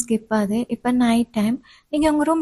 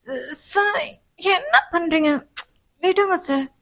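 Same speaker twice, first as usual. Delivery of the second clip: higher, slow startled protest; startled student; 5 semitones higher, slower than usual. Acted. Student protesting the professor's tight hug. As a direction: push him off, flustered.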